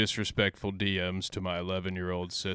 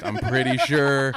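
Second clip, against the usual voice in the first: louder, slow, teasing warning; mock stern warning; 4 semitones higher, 9 dB louder, slower than usual. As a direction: drag it out like a playful scolding.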